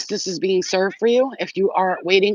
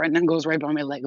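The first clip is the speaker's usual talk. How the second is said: ordinary delivery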